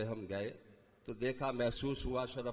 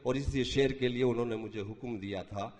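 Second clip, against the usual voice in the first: usual speaking voice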